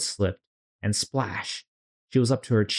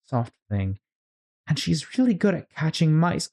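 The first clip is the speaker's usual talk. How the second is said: put-on girl voice; 8 semitones higher than usual. Alice continuing.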